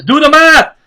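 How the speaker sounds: loud, high mock yell; imitated shout. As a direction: punch it out like a mocking catchphrase. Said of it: "Like Sam O'Day says".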